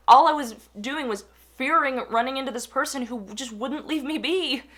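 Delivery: normal talking voice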